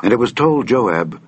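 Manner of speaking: usual speaking voice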